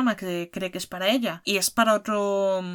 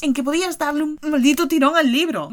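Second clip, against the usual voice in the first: exasperated exclamation; 7 semitones higher, 6 dB louder than usual. Real, not acted. Pleading exclamation to the author.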